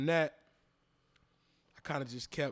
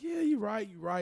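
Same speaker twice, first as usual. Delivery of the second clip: brushing off compliments; 6 semitones higher than usual. Retold or imitated. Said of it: "I would continue to be like".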